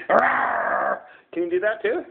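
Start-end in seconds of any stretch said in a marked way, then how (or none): 0.1-1.0 playful growly roar; monster roar. Acted. Parent showing a child the wild things' roar, playful roar.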